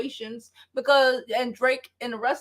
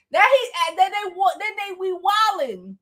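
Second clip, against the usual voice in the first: ordinary talk, nothing marked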